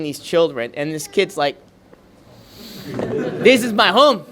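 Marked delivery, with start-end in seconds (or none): 2.9-4.2 put-on higher, louder and slow, a child standing firm; defiant child's cry. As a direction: voice the kid's stand, slow and proud. Child facing soldiers, quoted defiantly.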